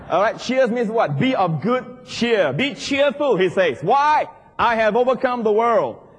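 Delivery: loud, high, fired-up preaching. Acted. Pastor fires up the congregation with loud high preaching. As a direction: preach it out to the crowd.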